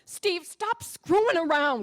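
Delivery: loud, slow, annoyed scold; wife scolds husband who says they're out. Acted. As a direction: tell him off, fed up.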